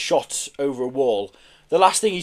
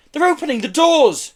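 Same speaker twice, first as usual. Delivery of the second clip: high, loud alarmed yell; 13 semitones higher, 6 dB louder than usual. A narrator's reading. Joe as doors are being rattled, yelling. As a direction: yell a warning. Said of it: "yelled Joe".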